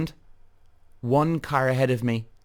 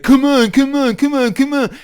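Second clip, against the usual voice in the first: high, loud, impatient mock nagging; impatient mock honking drivers; 12 semitones higher, 8 dB louder than usual. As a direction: hammer it like a rushing driver stuck behind you. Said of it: "They're like".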